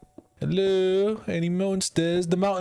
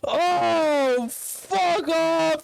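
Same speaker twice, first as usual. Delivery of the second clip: high shouted cursing; swearing tirade; 10 semitones higher, 3 dB louder, slower than usual. Real, not acted. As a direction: curse them out furiously. Swearing at skeletons.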